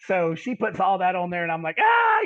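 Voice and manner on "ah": loud, high scream of pain; re-enacted high yell of pain; retold scream. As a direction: cry out as if the sting just hit. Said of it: "I'm screaming and everything".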